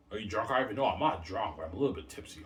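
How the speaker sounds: friend's voice. Pretend. Friend voiced asking if drunk.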